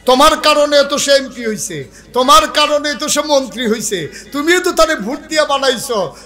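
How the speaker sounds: high-pitched, insistent sermon voice; ringing preacher's accusation; accusing preaching. Real, not acted. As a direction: drive the blame home to the congregation.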